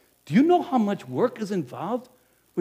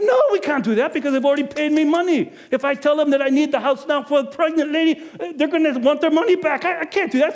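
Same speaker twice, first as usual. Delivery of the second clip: flustered refusal; 10 semitones higher, 6 dB louder than usual. Retold or imitated. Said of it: "I said".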